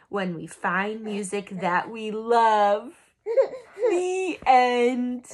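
soft, hushed bedtime reading; storybook read-aloud, soft and lilting; story reading. Acted. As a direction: read it gently like a picture book to a baby. Reading picture book aloud.

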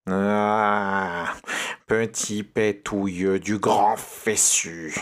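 harsh witch insults, slowing at the end. Acted. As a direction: spit out insults like an angry witch. The witch appears from the toilet.

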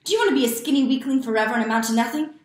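loud, fast furious scolding; father's angry tirade. A narrator's reading. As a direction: tear into the boy, rushing and shouting.

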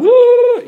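loud high whoop of someone going mad; mock frazzled whoop. Speaker acting out going mad from site demands, loud high whoop. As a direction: whoop like the messages are driving you crazy.

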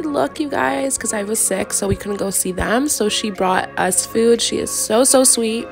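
ordinary talk

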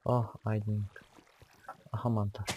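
usual speaking voice

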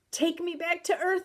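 high, loud frightened plea; terrified appeal; crying out. A narrator's reading. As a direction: beg to be taken back. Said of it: "I cried".

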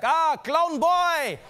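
loud, high, drawn-out mock bellow; powerful German greeting. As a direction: imitate a German dad booming hello. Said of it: "German is more powerful".

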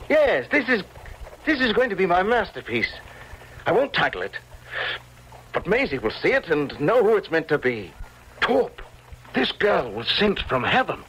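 high, exalted declaration; fervently excited. Acted. Painter to Torp about his new model, fervently excited. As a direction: declare it with soaring pitch, carried away. Painter seized by masterpiece idea.

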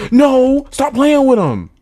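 very high, loud shouts stretching out; real full-voiced gamer yell; hyped screaming. Real, not acted. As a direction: scream it at the game, building to long held words. Gamer shouting in heated play.

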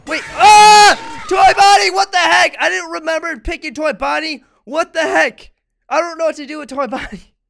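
high panicked yelling; scared horror game streamer. Real, not acted. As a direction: shout in fright at the jump scares.

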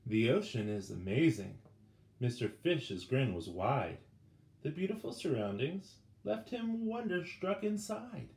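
ordinary delivery, nothing marked

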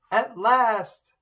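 a freed prince rejoicing, loud and high; joyful shout. A narrator's reading. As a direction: shout it out with relief. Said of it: "shouted the prince".

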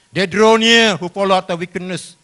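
loud, high pulpit voice; forceful scripture proclamation. Acted. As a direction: declaim the verse to the congregation. Proclaims the quoted verse.

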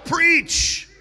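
loud, high, drawn-out command; shouted command. Real, not acted. Preacher has congregation command their neighbours, loud shouted command. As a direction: shout each phrase as an order, slowly and forcefully. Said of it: "say it to them as a command".

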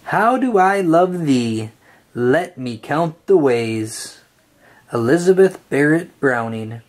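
plain reading voice

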